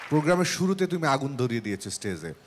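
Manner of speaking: ordinary talk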